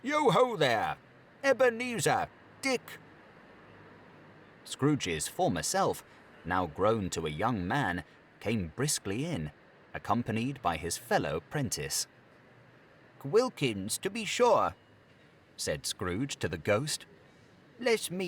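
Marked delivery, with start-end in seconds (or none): 0.0-3.0 much higher, louder hearty calling out; a fat, jolly master hailing his apprentices; jovial call. Acted. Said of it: "called out in a comfortably oily, rich, fat, jovial voice". 13.2-14.8 higher, louder glad exclamation; fondly recognizing an old friend; delighted recognition. Acted. Scrooge recognizes his fellow apprentice.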